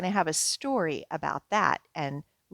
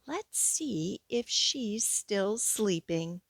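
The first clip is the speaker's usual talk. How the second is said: ordinary delivery, nothing marked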